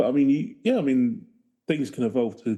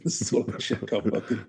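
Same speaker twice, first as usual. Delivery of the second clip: usual speaking voice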